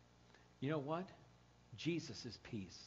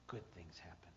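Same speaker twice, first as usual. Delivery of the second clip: hushed unvoiced whisper, much softer; 10 semitones lower, 9 dB quieter than usual. Real, not acted. Preacher closing on hope to the congregation, whispered. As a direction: let it fall to a whisper.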